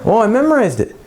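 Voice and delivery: high-pitched mimicked student excuse; mock defensive student. Student explaining a mistake to the teacher, in a high mock-defensive voice.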